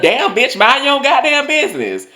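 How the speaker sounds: high-pitched, hammed-up indignation; mock outraged retort. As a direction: squeal it back in mock fury. Imagined angry retort to teacher.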